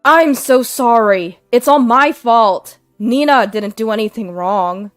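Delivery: husband pleading with everyone, loud and urgent; pleading apology. Acted. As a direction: beg forgiveness loudly. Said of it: "began to deeply apologize".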